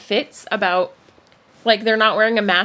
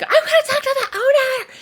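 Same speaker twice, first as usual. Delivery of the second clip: high, loud shriek imitating an irate customer; mock screaming; 5 dB louder than usual. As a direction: screech it like a hammed-up tantrum. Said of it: "yelling, screaming, causing a big mess".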